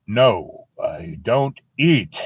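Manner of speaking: lightly voiced, slow, spaced-out refusal; Death refusing. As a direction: refuse word by word, unhurried. Death refuses the girl again.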